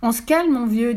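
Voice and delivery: high, loud telling-off; brisk rebuke. Acted. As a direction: cut in loudly, pitch jumping up. Fifi reins in raging Buck. (rappel à l'ordre, aigu et sonore)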